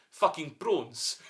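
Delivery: slow drawled South African phrase; slow South African accent, stretched out. Retold or imitated. Podcast host showing his jumping-off line, in a slow South African accent. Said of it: "I could do my South African".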